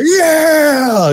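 high cheering yell; cheering shout. Retold or imitated. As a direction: cheer at the camera. Bar crowd cheers.

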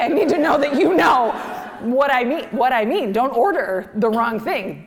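high, loud, urgent demand; insistent brother. Retold or imitated. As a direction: press every word as a desperate order. Insistent brother instructing funeral director.

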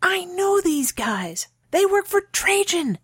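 high, slow, urgent hiss; frightened hiss. A narrator's reading. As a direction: hiss the warning, drawn out and high. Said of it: "she hissed".

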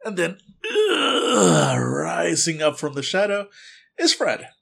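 high, loud, slow build of a creepy reveal; dramatic reveal. A narrator's reading. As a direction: narrate the monster rising with relish. Dramatic reveal of Fred rising.

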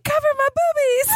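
put-on high little-kid squeak; imitating a toddler. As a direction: say it like the toddler did. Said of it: "she was like".

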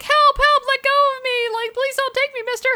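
very high, mock-frightened child's voice; a little girl play-acting a kidnapping, calling for help; little girl crying for help. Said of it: "the little girl's like".

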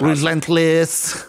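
slow French-style Relentless with French vowels; English said as French. Shown as a demonstration. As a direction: stretch out Relentless as if it were French. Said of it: "how do you say relentless in French".

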